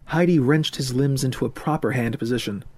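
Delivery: ordinary delivery, nothing marked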